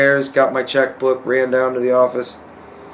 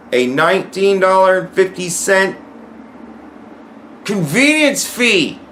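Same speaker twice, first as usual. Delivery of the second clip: exasperated punchline; 7 semitones higher than usual. Real, not acted. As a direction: drag out convenience fee in outrage. Punchline about the fee.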